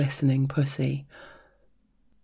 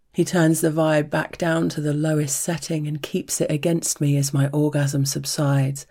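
ordinary delivery, nothing marked

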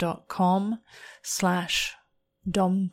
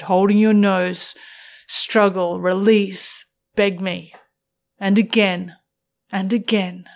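erotic story narration; 5 dB louder, faster than usual. A narrator's reading.

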